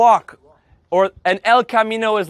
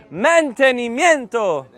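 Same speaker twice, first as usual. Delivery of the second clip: normal talking voice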